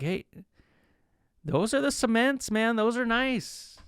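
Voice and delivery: high-pitched admiring hype; hyping up. Acted. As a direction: talk up a buddy's shoes, pitched high. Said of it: "I'm always hyping 'em up".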